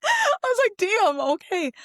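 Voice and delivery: high, loud, slowed stunned reaction; mock shock. Retelling her husband's harsh rating with mock shock. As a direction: act floored by his rating.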